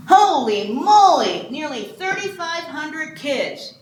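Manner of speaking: stretched-out gasp of disbelief; amazed exclamation. Real, not acted. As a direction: let the number astonish you. Quiz host reacts to big number.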